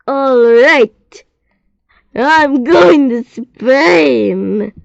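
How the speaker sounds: high, loud, very slow proclamation; grand declaration. A narrator's reading. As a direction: proclaim it like a big reveal. Teacher's grand Spain announcement.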